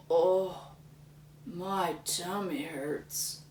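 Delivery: slow, soft groaning complaint; tummy ache. Acted. Lumberjack groaning after eating too much, soft and slow. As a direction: moan it weakly.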